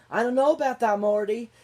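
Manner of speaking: loud, high-pitched character quote; hammed-up reply, pitched high and loud; mock teasing reply. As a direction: imitate a taunting movie line, way up high. Acted taunting reply to plea.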